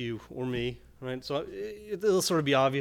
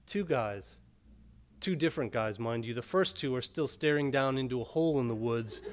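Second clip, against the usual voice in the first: measured, even poetry reading voice; poem reading; 5 dB louder than usual. A narrator's reading. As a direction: read it like a poet at a reading, unhurried. Poet reads poem aloud.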